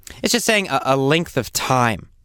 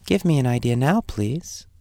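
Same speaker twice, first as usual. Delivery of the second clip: gentle tone. Shown as a demonstration. Teacher shows the opposite of harsh, gentle request. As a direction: ask gently, as a kind favor. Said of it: "That's a very gentle tone".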